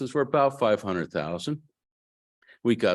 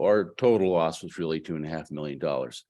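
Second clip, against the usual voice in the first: ordinary delivery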